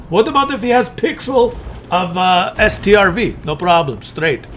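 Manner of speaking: mock Russian accent; lightly put-on Russian accent. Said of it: "Russian Cloak of Dispersion".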